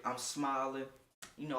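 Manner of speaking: normal talking voice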